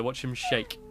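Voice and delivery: ordinary talk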